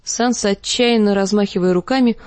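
ordinary delivery

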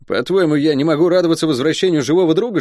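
high, quick, put-upon soldier voice; excitable guardsman, hurt and protesting; indignant guardsman, mock-offended. Guard Luk feigns offence.

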